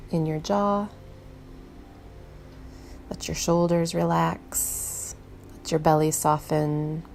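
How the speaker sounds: gentle meditation voice with long, unhurried pauses; soothing meditation guide, letting each instruction settle; guided meditation. Real, not acted. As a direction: ease the listener inward, leaving space between phrases.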